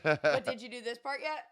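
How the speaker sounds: loud, high mock nagging; nagging project manager.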